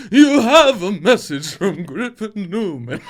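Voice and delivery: slow, grand announcement with a laugh; creepy butler. Pretend. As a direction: announce it like a stuffy butler reading a telegram. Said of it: "it's a fucking spooky butler".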